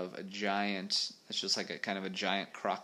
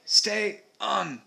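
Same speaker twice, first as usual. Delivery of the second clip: high-pitched urgent warning; shouting; 12 semitones higher, 9 dB louder than usual. A narrator's reading. Alf warning the boy as he fights, shouting. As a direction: call out sharply in a raised pitch. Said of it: "shouted Alf".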